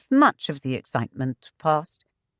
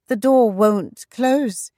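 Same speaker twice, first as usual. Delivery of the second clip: higher, louder and slow, teasing; lightly voiced impish wife; 6 semitones higher, 4 dB louder, slower than usual. A narrator's reading. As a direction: tease him playfully. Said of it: "she reminded him impishly".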